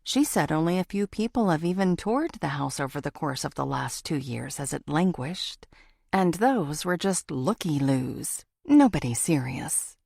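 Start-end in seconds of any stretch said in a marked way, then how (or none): none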